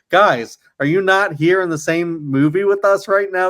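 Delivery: high, incredulous retold appeal; mock disbelief. Retelling a disbelieving quip to theatre.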